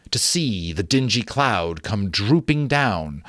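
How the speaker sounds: measured storytelling read; story narration. A narrator's reading. Reader narrating Dickens to listeners, storytelling voice. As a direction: tell it like a fireside tale. Reads the novel aloud.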